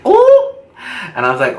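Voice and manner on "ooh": high excited "ooh"; excited squeal. Retold or imitated. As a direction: squeal with delight, high and loud. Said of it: "so I'm like".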